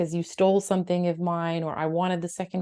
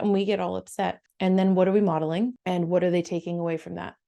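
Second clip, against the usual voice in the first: normal talking voice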